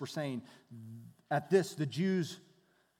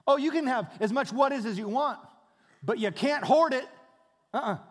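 louder, higher, playful warning; lively God voice; 7 semitones higher, 7 dB louder than usual. Acted. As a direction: give the rule with a teasing lilt. God setting manna rules.

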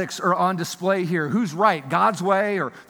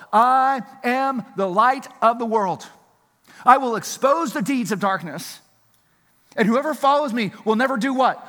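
rising proclamation; 5 semitones higher, 4 dB louder than usual. Acted. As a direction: proclaim it like a herald at dawn. Builds to Jesus' proclamation.

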